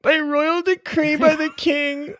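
high, proclaiming, teary herald; town crier. Acted. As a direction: announce it through tears. Crier repeats the decree.

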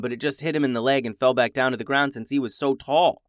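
ordinary delivery

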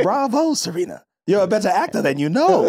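lightly mocking Diana's grand praise; a hint of theatrical villain; light mock of a scheming socialite; quoting Diana. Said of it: "Diana goes".

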